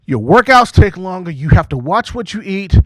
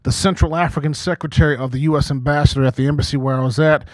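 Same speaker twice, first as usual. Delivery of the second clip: usual speaking voice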